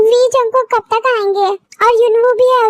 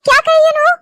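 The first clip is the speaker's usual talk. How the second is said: alarmed; 6 semitones higher than usual. Acted. Alarmed question about child.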